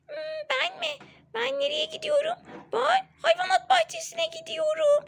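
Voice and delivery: put-on high, sweet toy baby voice; doll voice. Mother voicing the doll Baby for Melek Gül, high and sweet.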